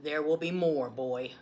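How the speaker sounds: usual speaking voice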